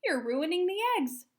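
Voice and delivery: high, loud dismayed cry; shouting. A narrator's reading. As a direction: scold the chicks in a panic. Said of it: "shouted at the unhatched chicks".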